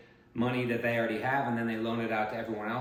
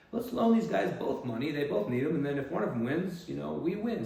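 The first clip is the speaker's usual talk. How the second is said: higher, slightly louder mock-eager pitch; lightly voiced scheming financiers; 5 semitones higher, 4 dB louder than usual. Lenders plotting to fund both sides of a war, mock eager. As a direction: propose it like a can't-lose scheme.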